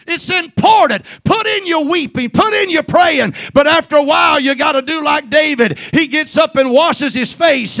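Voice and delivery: shouted, high-pitched pulpit crescendo; full-force preaching. Real, not acted. Preacher urging the church to stop weeping and celebrate, full-force shouted preaching. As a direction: build to a shouting climax, hammering each phrase.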